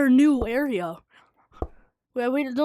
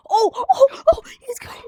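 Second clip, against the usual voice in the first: being chased; 12 semitones higher, 5 dB louder than usual. Real, not acted. Fleeing the monster.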